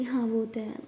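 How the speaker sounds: softly whispered, shy admission; hushed confession. Acted. Sara whispers to Jas that she misses her. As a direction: breathe it out like a secret. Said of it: "हंसते हुए कहती".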